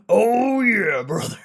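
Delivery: high, loud, strained promo cry; Macho Man voice. Retold or imitated. Streamer redoes his wrestler catchphrase, high and loud. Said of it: "I had that voice".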